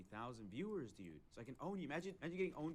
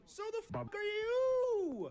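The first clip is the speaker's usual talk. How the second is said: loud outrage; 13 dB louder, much slower than usual. Real, not acted. Outraged retort.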